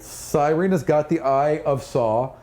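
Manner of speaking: normal talking voice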